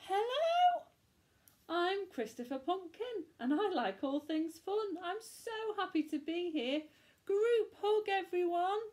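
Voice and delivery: very high, bright and bubbly pumpkin; cheery pumpkin voice. Acted. New pumpkin greeting the witch, high and eager. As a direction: squeal it out like an eager little pumpkin. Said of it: "Then her voice said".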